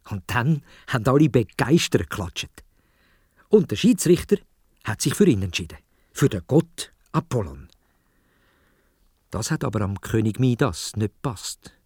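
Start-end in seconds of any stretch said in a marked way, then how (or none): none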